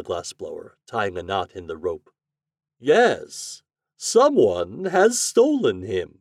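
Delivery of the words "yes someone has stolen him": high, loud, drawn-out wizard voice; slow storybook wizard's lament. A narrator's reading. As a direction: stretch the words high and theatrically. Wizard laments his stolen dog.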